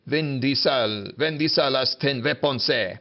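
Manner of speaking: "Vin Diesel has ten weapons, eh" as Spanish ven di sal haz ten ve pon sé; Spanish pronunciation. Shown as a demonstration. Teacher recapping the memory phrase, "Vin Diesel has ten weapons, eh" pronounced as Spanish. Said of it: "How does it work?".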